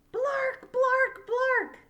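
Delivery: high, loud squawking birdcall; three sharp squawks; bird squawks. Acted. Little bird proudly squawking his new word.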